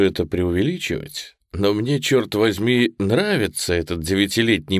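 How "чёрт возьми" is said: plain reading voice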